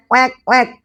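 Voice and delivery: put-on high playful quack for kids; high duck quack, playful. Shown as a demonstration. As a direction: quack like a duck, bright and bouncy. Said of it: "Tangannya gini ya".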